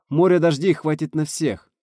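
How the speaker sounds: high, loud argument; exclamation. A narrator's reading. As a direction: call it out to the whole crew. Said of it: "воскликнул селенолог". (громкое восклицание)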